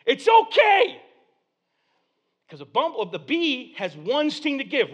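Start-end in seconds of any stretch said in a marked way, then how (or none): 0.0-1.0 high, loud shout over screaming; acted father hollering reassurance; dad calling out. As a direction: shout to settle panicking kids.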